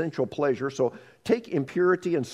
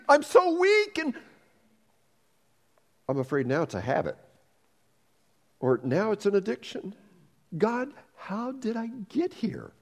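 hammed-up anguish; 7 semitones higher than usual. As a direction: plead with God, mock despair. Acted sinner despairs.

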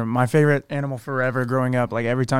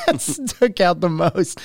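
talking through real laughter, drawn out; laughing; 4 semitones higher, much slower than usual. Real, not acted. Teased about the most meaningful thing heard, laughing speech. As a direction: squeeze the words out while cracking up. Cracking up at teasing about sermon.

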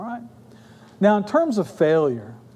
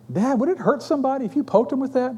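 put-on higher-pitched curious kid; young son asking; 7 semitones higher, faster than usual. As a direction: ask Dad in a higher, boyish voice. Voices young son asking father.

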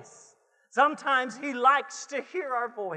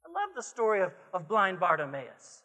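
ordinary talk, nothing marked

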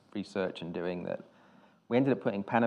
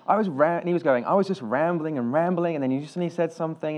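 higher, louder, animated quote; exasperated retelling; 7 semitones higher, 5 dB louder than usual. Retold or imitated. Retelling the writer's complaint after the meeting, worked up. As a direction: act out a worked-up friend protesting.

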